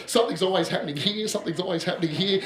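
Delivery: rattled off fast, no full stops; lightly voiced city talker, quick; fast city patter. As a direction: gabble it like a busy Sydneysider. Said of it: "your average person from the city".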